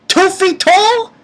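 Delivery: loud, high, drawn-out disbelief; retold scoffing question; incredulous. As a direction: stretch it out high, mocking the tiny bear. Retells own jibe at the bear.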